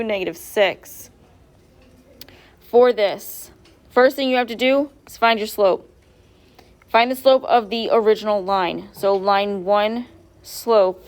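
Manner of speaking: ordinary talk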